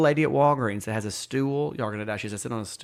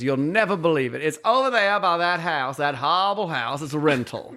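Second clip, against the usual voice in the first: higher, theatrical grandmother voice; imitated old lady dishing in big swoops; Swoopy gossiping; 4 semitones higher, 3 dB louder than usual. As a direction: lean hard on the scandalous words. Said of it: "that's how she talked".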